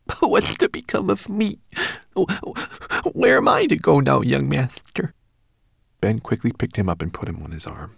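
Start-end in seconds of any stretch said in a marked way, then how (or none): 0.1-5.1 high, wailing, stammering through sobs; tearful sobbing. A narrator's reading. Homunculus to young master, fearing abandonment, sobbing. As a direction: wail it as a tiny distraught creature. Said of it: "the homunculus sobbed even louder".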